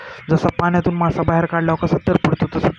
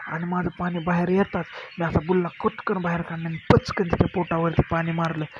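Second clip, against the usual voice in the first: plain reading voice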